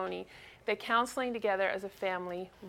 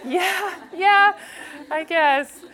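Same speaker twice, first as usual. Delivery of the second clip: higher, louder, laughing embarrassed yes; laughing sheepishly; 6 semitones higher, 7 dB louder, much slower than usual. Real, not acted. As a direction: brush off the praise with a laugh. Embarrassed at son's remark.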